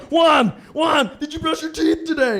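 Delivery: his own mind yelling a warning at him; inner voice shouting. Acted. His mind yelling at Juan before the girl passes, loud alarm. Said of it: "So your mind's like".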